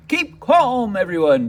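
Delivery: a loud, high call for calm over a crowd; bellowing. Acted. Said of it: "bellowed C J".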